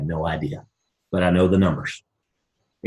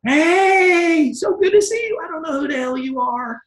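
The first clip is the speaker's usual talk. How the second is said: fake friendly greeting. Pretending to recognize a stranger, gushing and high. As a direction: overdo the delight of meeting. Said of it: "I did that to a whole bunch".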